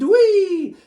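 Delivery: high, loud playground whee; gleeful scream. Acted. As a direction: squeal with delight down the slide.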